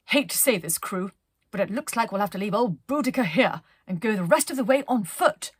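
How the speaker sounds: Penelope's voice. Acted. Penelope tells her crew they must walk, brisk and loud. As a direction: break the bad news briskly. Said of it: "stopped and said".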